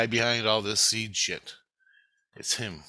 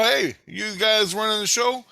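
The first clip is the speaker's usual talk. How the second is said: higher, louder retold challenge; confrontational call; 11 semitones higher, 5 dB louder than usual. Intruder confronts lab scientist, loud challenge. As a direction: call him out across the lab.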